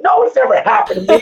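laughing answer. Real, not acted. Answering buyer while laughing.

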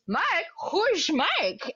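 high mock Dutch accent; baffled question in a put-on Dutch voice; Goldmember. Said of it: "Mike as Goldmember gone completely full method".

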